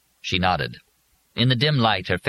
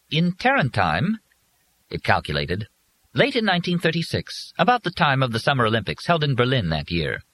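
high, precise, polite robot voice; prim robot servant; 6 semitones higher than usual. Acted. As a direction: stiff dutiful machine, calmly reporting. Robot Willis voiced throughout.

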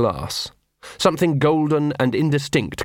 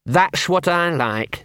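bitter; 6 semitones higher, much slower than usual. Acted. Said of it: "said Gaspode bitterly".

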